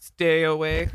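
robotic nineteen eighties computer voice; old speech synthesizer. Pretend. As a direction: say it like a retro talking computer. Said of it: "a nineteen eighties computer voice".

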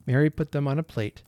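plain reading voice

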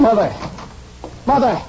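crying out Mother; calling out. Acted. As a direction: call her back desperately. Son calling after leaving mother.

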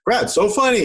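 high, put-on endorser voice; high-pitched parody of a satisfied customer; mock testimonial. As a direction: pitch it up like a gushing fan. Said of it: "Maybe I'll get a testimonial".

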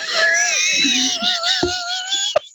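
loud helpless laughter; crying with laughter; laughing hard. Real, not acted. Kid's rectangle earth answer.

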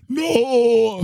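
high anguished cry of no; Charlton Heston despair. Retold or imitated. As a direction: howl it like a ruined hero. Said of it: "Like Charlton Heston".

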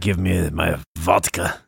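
slow, hammed-up Russian accent. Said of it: "Can you do a Russian accent?".

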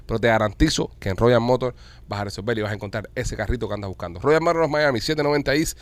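upbeat radio pitch for a car dealer; live ad read. Acted. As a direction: sell it like a live on-air sponsor spot. Host reads car dealer ad. (lectura de anuncio en vivo, animada)

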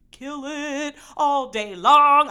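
slow, loud and high, hyping herself up; hyped up. Real, not acted. Podcaster hyping her egg plan, slow and loud. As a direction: stretch it out like a victory chant. Bragging about egg wraps.

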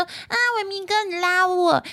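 high breathy coy plea; cutesy whiny girl. Retold or imitated. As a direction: play the clingy girl asking. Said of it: "那女生就很奶在撒娇".

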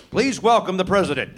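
usual speaking voice

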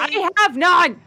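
exasperated cry. Real, not acted. No healing left, panicking.

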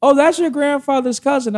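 high-pitched mom revelation; high mock mother's voice; imitating his mother. Voices mother revealing the relation.

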